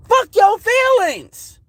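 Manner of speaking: slow, high, drawn-out defiance; high slow defiant jab. Real, not acted. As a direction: spit it out slowly and high, daring them. Defiant rant at critics.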